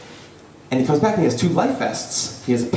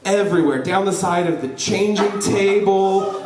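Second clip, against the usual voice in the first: usual speaking voice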